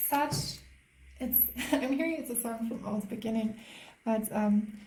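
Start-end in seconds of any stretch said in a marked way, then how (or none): none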